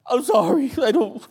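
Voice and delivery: high, loud tearful apology; mock bawling. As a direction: blubber an apology in a comically high voice.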